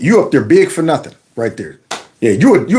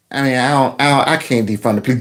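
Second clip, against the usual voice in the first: lower, stammered excuse; sheepish politician; 4 semitones lower, 3 dB quieter than usual. Acted. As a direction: back down haltingly as the cornered politician. Voices politician backing down to crowd.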